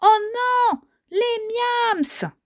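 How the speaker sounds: high-pitched cartoon cry of alarm. Characters alarmed as miams appear. (cri d'alarme aigu)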